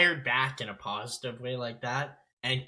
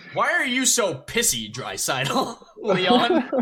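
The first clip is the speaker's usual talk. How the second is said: higher, louder mock-indignant question; reporter's pointed jab; 8 semitones higher, 6 dB louder, slower than usual. Reporter needling a hockey player in a press conference, mock-indignant. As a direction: imitate an exasperated reporter, breaking into laughter. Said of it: "Jim Matheson coming out and saying".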